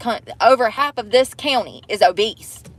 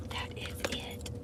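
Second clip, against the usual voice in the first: whisper; 19 dB quieter than usual. Real, not acted. Repeating that's it, fading.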